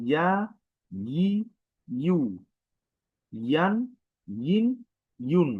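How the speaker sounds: ইয়া ই ইউ ইয়ান ইন ইউন pushed out with pressure; forceful recitation of ইয়া ই ইউ; pressed vowel drill. Shown as a demonstration. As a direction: press each sound out for the class. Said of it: "আবার বলি".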